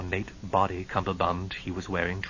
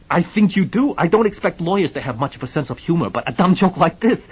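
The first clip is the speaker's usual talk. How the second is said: loud, high indignant retort; stung and huffy; put-out client snapping back; offended Hennessy; 8 semitones higher, 11 dB louder than usual. Acted.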